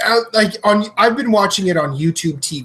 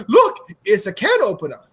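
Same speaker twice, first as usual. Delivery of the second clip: higher-pitched mock Swiss accent; 4 semitones higher than usual. Swiss guy showing off his army knife, mock Swiss accent. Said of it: "I'm gonna do an accent".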